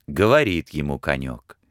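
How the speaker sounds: usual speaking voice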